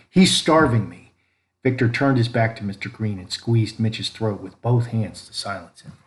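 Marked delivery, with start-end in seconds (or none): 0.1-1.1 pleading cry. A narrator's reading. Boy begs neighbor for help.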